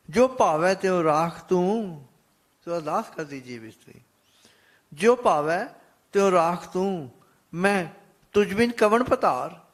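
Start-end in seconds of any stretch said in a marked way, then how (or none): 0.1-2.1 raised, louder devotional chanting tone; reciting Gurbani with devotion; scripture recitation. Acted. 4.9-9.6 raised, louder devotional chanting tone; reciting Gurbani with devotion; scripture recitation. Acted.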